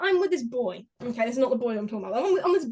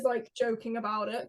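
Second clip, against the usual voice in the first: ordinary talk, nothing marked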